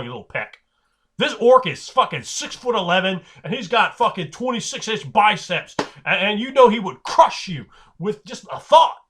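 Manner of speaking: loud, high, incredulous rant; forceful intimidation. Acted. Game master warning tiny halfling about huge orc, forceful intimidation. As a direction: hammer home how hopelessly outmatched they are.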